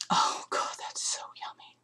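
whispered, breathless delight. Real, not acted. Host whispering after sniffing the balance soap, hushed delight. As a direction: breathe it out in a near-silent whisper.

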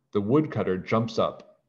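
ordinary delivery, nothing marked

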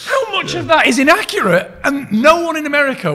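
loud, high comic rant; exasperated, high-pitched disbelief; incredulous outburst. Acted. Continues mock outrage at the inaccuracy.

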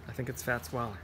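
plain reading voice

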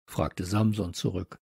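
plain reading voice

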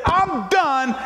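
a loud victorious cry. Retold or imitated. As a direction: declare it loud for all to hear. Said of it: "He stood up with a loud voice".

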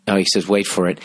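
ordinary talk, nothing marked

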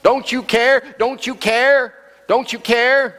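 high, pleading cry to the master; frightened disciples begging over the storm; panicked cry. Acted. Disciples crying out to Jesus in the storm, high and pleading.

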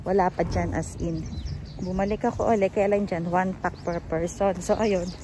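muffled behind mask; speaking into cloth mask; through a face mask. Real, not acted.

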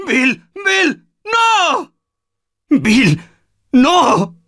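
high anguished cries; grief-stricken shouting. Acted. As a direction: call out in despair. Bill dies.

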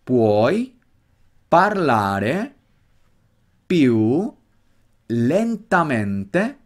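slow Italian drill, pausing after each word. Shown as a demonstration. Teacher drills the Italian question for students, slow word-by-word. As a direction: separate every word and stretch it out. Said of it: "ahora velocidad un poquito más natural".

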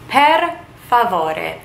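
slow, careful Italian per favore with rolled r. Shown as a demonstration. Teacher showing viewers, slow careful per favore. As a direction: sound out per favore slowly for learners. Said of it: "you would say please".

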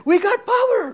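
high, excited cheer about the new power; mock celebration. First guy with a light bulb cheering to the street, high and excited. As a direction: shout it like you're dancing in the street. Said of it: "dance on the streets like".

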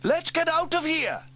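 very high, loud panic; alarmed shout. Acted. Firemen realise the extinguisher will hit them, alarmed.

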